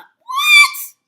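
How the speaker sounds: shrieked high and loud in disbelief; loud, high shocked shriek of disbelief; outraged what. Real, not acted. Outraged reaction.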